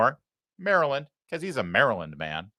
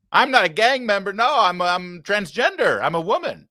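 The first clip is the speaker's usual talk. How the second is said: higher, louder hammed-up mock plea; mock defendant; 8 semitones higher, 4 dB louder than usual. Host mocking the deportee's imagined defense, hammed-up and higher. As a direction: ham up a fake courtroom claim. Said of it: "is that his new defense".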